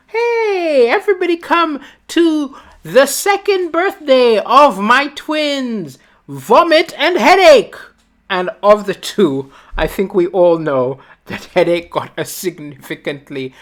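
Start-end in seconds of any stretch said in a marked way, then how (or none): 0.1-7.8 high cheery party invite, slow on my twins; proud parent. Retold or imitated. Parent inviting everyone to the twins' birthday, cheerful. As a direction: announce it like a beaming mom. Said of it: "It would have been like".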